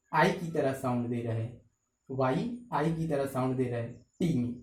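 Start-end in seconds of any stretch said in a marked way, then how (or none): none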